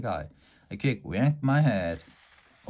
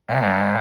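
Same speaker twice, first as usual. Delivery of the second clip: short playful screech "Ah!"; a loud mock monster screech; 2 semitones lower, 6 dB louder than usual. A narrator's reading. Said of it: "I screeched".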